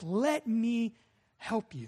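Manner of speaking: normal talking voice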